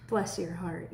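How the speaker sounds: low, soft sarcastic Bless your heart; dry mock pity for someone acting dumb. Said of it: "said sarcastically".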